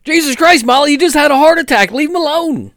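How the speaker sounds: high, loud outraged scolding; exasperated shout. Pretend. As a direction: yell at the coworker in disbelief. Paramedic scolding in ambulance.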